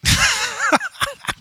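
high burst of helpless laughter; real laugh. Real, not acted. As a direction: crack up at the punchline for real. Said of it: "Sorry, I'm laughing".

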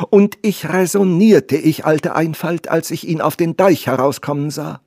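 put-on high, loud, emotional old nursemaid; agitated old servant. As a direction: pour out relief after a fright. Old servant voiced after the rescue.

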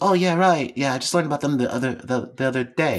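put-on high British girl voice; put-on British accent, high; British girl's accent. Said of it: "the girl's like".

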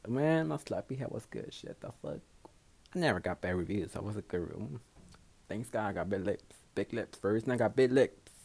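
normal talking voice

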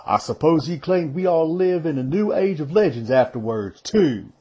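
a touch of exasperation; mildly fed up with a friend's fancies; exasperated. Acted. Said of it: "a touch of that in his voice".

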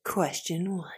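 dramatic whispered announcement; whispering. Pretend. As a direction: whisper like a tense quiz host.

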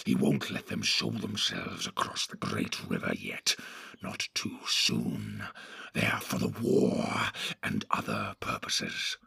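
a low menacing creature, scheming; Grishnak the sly orc. Acted. Grishnak continues.

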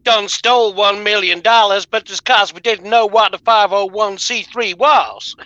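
hammed-up Deep South voice, slowing at the end; Deep South accent. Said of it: "that was my Deep South voice".

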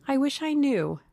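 Duchess character voice. A narrator's reading. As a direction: lift it high in a wistful wish. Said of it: "she said at last".

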